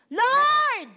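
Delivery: loud, high desperate shout; shouted cry. Retold or imitated. Preacher acting the blind men shouting to Jesus, loud desperate cry. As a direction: cry out to the Lord at full voice. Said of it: "they shouted".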